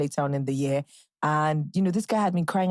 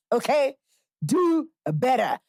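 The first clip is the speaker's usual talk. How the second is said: scolding; 6 semitones higher, slower than usual. Real, not acted. Scolding viewers for their searches.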